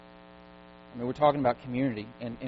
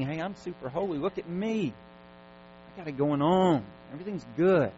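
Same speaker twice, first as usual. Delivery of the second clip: phony holy person; 5 semitones higher, 4 dB louder than usual. Acted. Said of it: "when people are fake, phony, and pretending".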